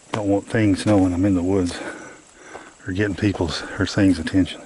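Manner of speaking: loud, pushed voice. Real, not acted. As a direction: project so the camera hears. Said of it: "talk real loud".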